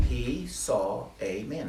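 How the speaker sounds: "He saw a man" stretched out word by word; slow emphasis. Shown as a demonstration. Preacher drives home what the verse says, slow and emphatic. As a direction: spell out "He saw a man" slowly, each word weighed.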